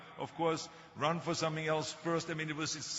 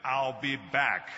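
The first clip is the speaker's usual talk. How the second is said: Terminator line; 6 dB louder, much slower than usual. Retold or imitated. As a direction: deliver it flat and slow, a movie icon line. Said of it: "when I did Terminator".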